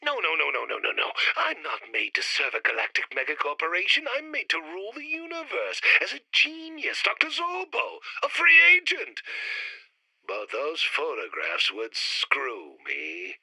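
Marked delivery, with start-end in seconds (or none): 4.2-9.2 grandiose. Acted. Villain proclaims grand ambition.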